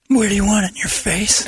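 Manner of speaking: high hammy dirty talk; mock dirty talk. Shown as a demonstration. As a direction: ham up the porn line. Demonstrating dirty phrase.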